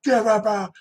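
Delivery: high-pitched retold yell, not full volume; held-back imitation of screaming; mock scream. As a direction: imitate a man yelling, pitch pushed way up. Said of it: "the guy went up screaming".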